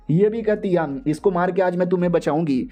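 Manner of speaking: usual speaking voice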